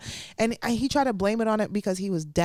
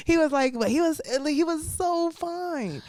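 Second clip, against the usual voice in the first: swooning; 9 semitones higher, slower than usual. Real, not acted. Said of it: "swooning over date".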